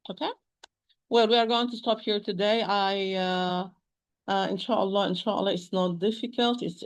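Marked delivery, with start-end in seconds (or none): none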